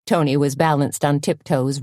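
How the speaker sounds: plain reading voice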